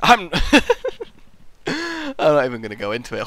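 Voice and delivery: normal talking voice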